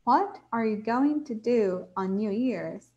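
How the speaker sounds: slow, clear classroom reading; slow model reading. Shown as a demonstration. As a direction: read each word slowly for students. (leitura pausada e didática)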